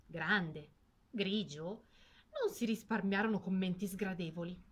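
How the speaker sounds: ordinary delivery, nothing marked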